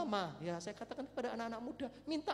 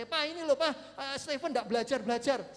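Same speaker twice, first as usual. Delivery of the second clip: mother's loud, high complaint, imitated; retold yelling; 5 semitones higher, 9 dB louder than usual. Mother yells to father that son won't study, imitated shouting. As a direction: yell it like a nagging mother. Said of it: "teriak sama saya".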